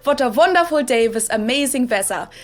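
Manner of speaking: German accent, "wonderful" as "vonderful"; strong German accent, V for every W. Shown as a demonstration. Teacher showing viewers a German accent, strong and demonstrative. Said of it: "do a strong German accent".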